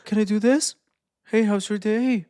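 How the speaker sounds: high, eager people-pleaser voice; sugary high asking voice; pleasing tonality. Shown as a demonstration. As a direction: try hard to please them. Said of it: "By talking in a pleasing vocal tonality".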